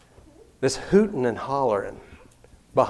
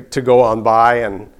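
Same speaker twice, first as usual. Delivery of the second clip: ordinary delivery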